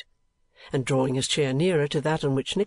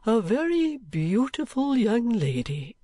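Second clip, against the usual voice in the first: put-on slow, higher-pitched grave old gentleman; 9 semitones higher, slower than usual. A narrator's reading. Old gentleman telling Nicholas of the lady, grave. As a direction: dwell gravely on each word. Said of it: "said Mister Cheeryble gravely".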